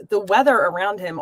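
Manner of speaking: usual speaking voice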